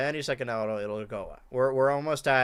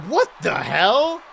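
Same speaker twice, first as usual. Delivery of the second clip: disbelief; 5 semitones higher, 6 dB louder, much slower than usual. Real, not acted. Confusing finish.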